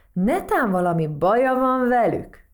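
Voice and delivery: adventure-film voice. A narrator's reading. Toad questioning Matild as if in adventure film, dramatic. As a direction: ask it like a film trailer's intrigue. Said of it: "olyan hangon, mintha egy kalandfilmet".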